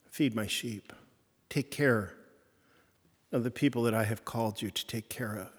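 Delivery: soft, tender instruction from Jesus; gentle charge. Retold or imitated. Jesus gently charging Simon, soft and tender. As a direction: lay the task on him softly.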